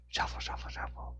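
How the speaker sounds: hushed, whispered shuffling; whispered sound effect. A narrator's reading. Narrator imitating something moving in the alley, whispered sound effect. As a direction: whisper it like feet scuffing in the dark.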